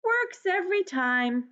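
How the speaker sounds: a hint of the high mouse voice; lightly voiced smug little mouse. Mouse boasting to the animals, lightly squeaky. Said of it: "Mouse said".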